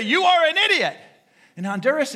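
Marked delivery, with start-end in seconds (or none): 0.0-1.0 a loud, higher mock honk of contempt; angry horn blast voiced. Preacher voicing his own horn calling a driver an idiot. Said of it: "I say".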